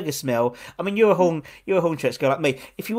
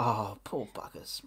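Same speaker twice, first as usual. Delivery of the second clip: slow, soft whispered aside; whispered pity; 6 semitones lower, 12 dB quieter, slower than usual. Retold or imitated. Host recalling getting texts about the match, slow whisper. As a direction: breathe it out like a pitying murmur. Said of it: "and I was like".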